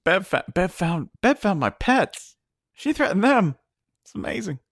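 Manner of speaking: mock awestruck fan. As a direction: gasp it out like a stunned fan. Imitating a fan gushing about Bev.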